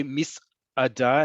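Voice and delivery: "misada" with a clear break before "ada"; break inside "misada". Shown as a demonstration. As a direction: split "misada" into mis, then ada. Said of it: "have that little break there".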